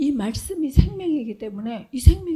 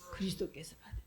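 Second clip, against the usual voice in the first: breathy reverent whisper; 5 semitones lower, 14 dB quieter, faster than usual. Shown as a demonstration. Preacher answers own question to congregation, whispered. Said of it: "whispered answer to own question".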